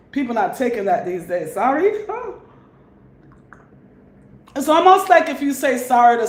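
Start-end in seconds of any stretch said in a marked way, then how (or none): 1.5-2.3 scornful disbelief. Acted. Mimics people rejecting an apology.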